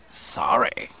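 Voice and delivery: low, raspy mocking echo of an apology; derisive mimicry. Stubby human mimicking Luke's apology, derisively. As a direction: throw it back as a mocking question. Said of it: "the human mimicked derisively".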